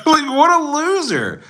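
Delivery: very high laughing jeer; mocking laughter. Real, not acted. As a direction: jeer at them through laughter. Laughing mockery of the commenter.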